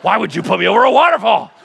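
half-laughing outrage at the idea; mock horror. Child protesting to God about the waterfall, laughing in mock horror. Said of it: "You're like".

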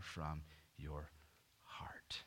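breathy whispered ending. Real, not acted. Preacher warning the congregation, ending in a whisper. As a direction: drop to a whisper on the last words. Sermon line ends in hushed emphasis.

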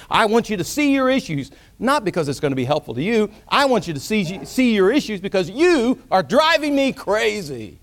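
high, loud fed-up rant; hammed-up exasperation, stretched out at the end; exasperated. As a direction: tell someone off for driving you nuts. Acted fed-up critic.

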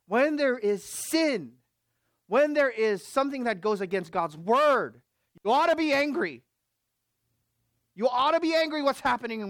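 loud, high, building preacher's cry; rally them to righteous anger; preaching at full force. Acted. Preacher stirring the church to anger, loud and high.

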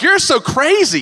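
high, loud, drawn-out mock disbelief; mocking friends. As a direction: play the scoffing American friends. American friends' reaction.

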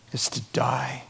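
hushed solemn whisper. Real, not acted. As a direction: drop to a whisper for the warning. Solemn warning in sermon.

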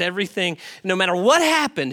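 normal talking voice

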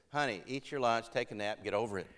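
low, soft, matter-of-fact advice; unimpressed dismissal. Retold or imitated. Wife telling wounded preacher to get over it, unimpressed. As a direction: deflate the drama with understated calm.

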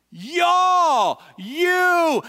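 "Y'all" and "you" high and drawn out; emphatic calling. Shown as a demonstration. Preacher pointing at the congregation, emphatic "Y'all" and "you". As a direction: ring out "Y'all" and "you", each held long. Preacher hammers who is meant.

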